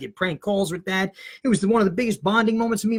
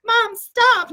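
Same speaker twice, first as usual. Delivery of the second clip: mock scream; 11 semitones higher, 5 dB louder than usual. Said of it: "Now I'm screaming at my mom".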